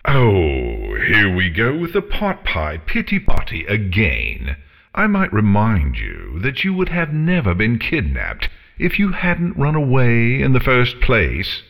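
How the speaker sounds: snorting, haughty dragon, swooping up high; exasperated dragon, rising in pitch as she lectures; scornful dragon. A narrator's reading. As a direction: scold with theatrical disdain. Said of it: "Scarlet snorted a blast of hot breath".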